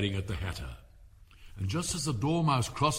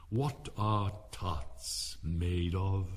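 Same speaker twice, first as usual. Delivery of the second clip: very slow, lower, put-on deep voice; 4 semitones lower, much slower than usual. Frowning king questions the cook in a slow deep voice. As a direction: ask it slowly and deep. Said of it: "he said in a deep voice".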